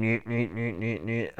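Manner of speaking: soft, repeated robot bleat; quiet looping "nyeh" mouth noise; robot whining noise. Acted. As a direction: imitate a broken little robot's siren. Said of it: "blue robot is still sitting on the ground, going".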